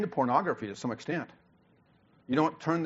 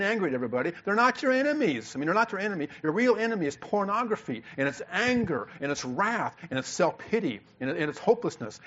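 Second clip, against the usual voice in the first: heated, higher-pitched rebuke to the congregation; impassioned preaching; 5 semitones higher than usual. Real, not acted. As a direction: preach hard, driving each charge home. Preaching at full force.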